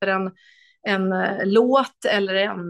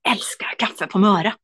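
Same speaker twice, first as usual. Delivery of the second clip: whisper. Real, not acted. Tender personal story, whispered.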